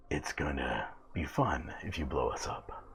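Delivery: low, soft whisper; sinister low whispered character voice; dreaded villain voice. Acted. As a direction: whisper it low from just behind them. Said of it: "Don't do the voice".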